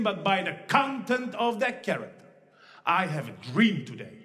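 rising, building oratory; formal speech. Acted. MC reciting the famous speech in full oratory. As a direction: preach it like King from the steps.

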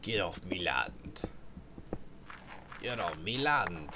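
slow gruff pirate drawl, like a farmer shooing trespassers; slow, growled old-sea-dog accent. Pretend. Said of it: "Why did it have to sound like a pirate?".

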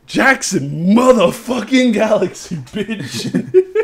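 slow, loud, laughing hype; hyped up. Real, not acted. Hyping a favourite TV cat expert, slow and loud. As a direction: draw the name out in delight.

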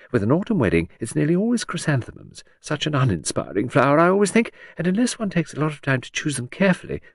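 lilting high society lady; chattering aristocrat. Acted. Hostess prattling to Edward about the wedding. Lucy rambling on flowers.

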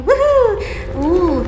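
high, loud victory whoop; sudden high celebratory woohoo; cheer. Real, not acted. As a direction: shout it out like you just won. Cheering after reaching a checkpoint.

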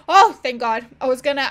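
suddenly loud thank God; loud relieved outburst. Real, not acted. Relief when car appears.

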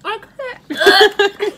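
loud, high, disgusted; forcing out words around a bad bean; eating gross jelly beans. Real, not acted.

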